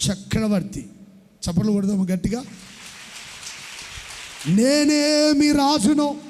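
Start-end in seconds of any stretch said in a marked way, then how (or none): 4.5-6.2 loud, slow proclamation; preaching. Acted. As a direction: boom it like a decree. Booms God's line.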